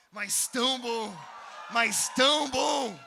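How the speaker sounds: slow, loud, stretched out; drawn-out shout. Retold or imitated. Preacher telling the dealer, shouting slowly.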